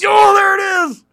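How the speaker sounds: high, loud burst of recognition; excited shout. Real, not acted. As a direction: shout it out in delight. Finally remembers the name.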